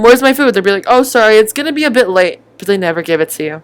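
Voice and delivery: ordinary talk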